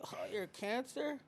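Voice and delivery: high, cooing mock baby talk; mocking someone over their star sign, cooing high; mocking sweet talk. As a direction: coo it sarcastically like teasing a friend. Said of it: "We're like".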